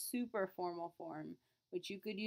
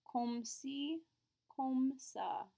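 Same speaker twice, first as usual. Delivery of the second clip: slow model; 5 semitones higher, much slower than usual. Shown as a demonstration. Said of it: "Another way to say okay".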